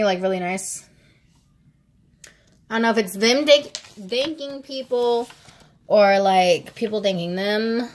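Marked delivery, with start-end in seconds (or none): none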